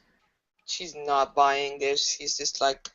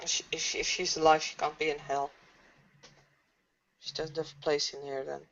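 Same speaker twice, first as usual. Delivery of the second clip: soft, hesitant murmur; small, hushed voice; quiet doubt; 8 dB quieter, faster than usual. Acted. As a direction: reason it out timidly under your breath. Shaken character answers tormentor.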